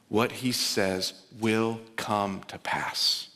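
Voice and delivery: What he says will come to pass, each word spaced out slowly; slow declaration. Shown as a demonstration. Preacher assuring Christ followers of God's word, very slow. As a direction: lay every word down deliberately. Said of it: "slow repeated declaration".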